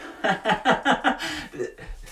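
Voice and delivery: high, burst of laughter; big laugh. Real, not acted. Cracking up at a listener's story.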